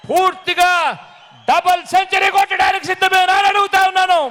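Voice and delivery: high, fast shouted question to the crowd; ringing climax of a campaign speech; rousing rally challenge. Real, not acted. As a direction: fire up the crowd for a big answer.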